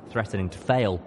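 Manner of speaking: ordinary delivery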